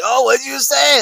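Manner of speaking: high, loud Italian waiter. Retold or imitated. Retelling the comedian's story, an Italian waiter shouts high. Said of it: "he was like".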